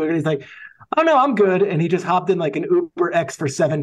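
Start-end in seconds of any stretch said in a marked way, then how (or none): 1.0-1.6 higher and louder, airy no-thanks; a casual star turning down a car service; breezy brush-off. Retold or imitated. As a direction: wave off the offer lightly. Host retells A-Rod declining driver.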